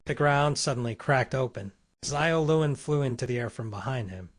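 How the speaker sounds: ordinary delivery, nothing marked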